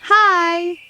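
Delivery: ordinary talk, nothing marked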